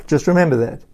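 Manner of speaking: normal talking voice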